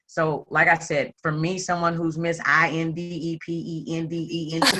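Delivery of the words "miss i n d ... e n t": spelling out INDEPENDENT letter by letter; spelled out. Shown as a demonstration. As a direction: spell I N D E P E N D E N T one letter at a time. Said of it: "y'all know what that means".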